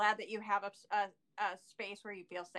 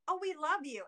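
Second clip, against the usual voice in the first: higher-pitched imitation of adoring fans; mock adoring squeal, kept small; gushing fan; 7 semitones higher, 3 dB louder than usual. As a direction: gush like a commenter. Retold gushing fan comments.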